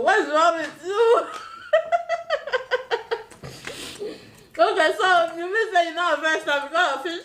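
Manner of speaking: laughing hard. Real, not acted.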